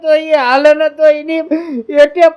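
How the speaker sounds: hammed-up tearful wail to uncle; mock sobbing lament. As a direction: sob through the complaint, dragging out the ending. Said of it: "રોવું નથી".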